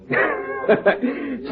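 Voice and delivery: short breathy chuckle. Acted. Barman chuckling at the drunk tramp's exit, short laugh. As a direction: laugh under the breath at the memory.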